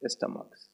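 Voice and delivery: stomachs said "stuh-muks". Shown as a demonstration. Teacher gives students the plural, stomachs with CH as "k". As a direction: say stomachs with a "k" before the s. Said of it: "स्टमक बोलेंगे".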